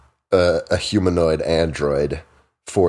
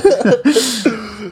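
high laugh; 13 semitones higher than usual. Real, not acted. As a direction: crack up laughing. Keeps laughing.

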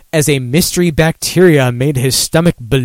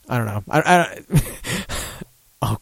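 ordinary talk

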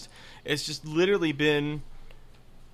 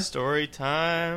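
playful drawn-out announcement; much slower than usual. Pretend. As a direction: stretch the words out slowly.

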